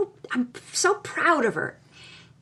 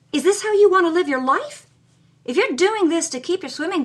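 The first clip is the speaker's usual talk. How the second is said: urgent challenge; 5 semitones higher, 5 dB louder than usual. A narrator's reading. Elder pleading forcefully.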